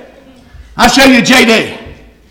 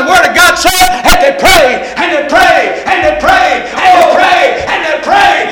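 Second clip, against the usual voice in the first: preaching at full force; 8 semitones higher than usual. Acted. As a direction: drive the story of Elijah home to the congregation. Preacher building to a shout.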